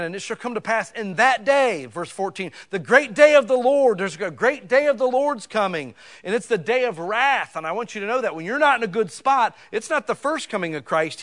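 loud, high preaching of doom; full-force preaching. Acted. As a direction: thunder the scripture at the congregation. Reads scripture at full force.

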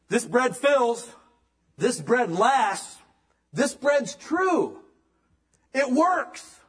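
high, loud and slow, each phrase hammered home; preaching at full force. Acted. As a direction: proclaim it like a revival preacher. Preaching the bread of life.

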